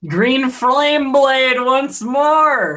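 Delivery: high, drawn-out spell call; slow, ringing call to battle; triumphant battle cry. Pretend. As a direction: declare the attack with a rising, stretched flourish. Calling out a spell attack.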